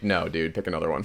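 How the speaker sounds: a laid-back producer brushing it off, low. Retold or imitated. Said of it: "he's like".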